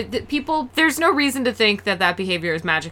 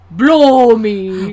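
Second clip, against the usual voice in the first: mock Scottish accent; 2 semitones higher, 10 dB louder than usual. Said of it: "How do you do a Scottish accent?".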